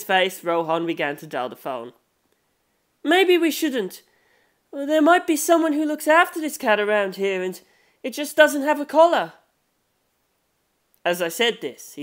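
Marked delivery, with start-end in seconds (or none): 3.0-9.3 put-on higher, hesitant young voice, a little louder; high-pitched, hesitant young narrator voice; young companion voice. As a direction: voice the nervous companion talking him out of calling.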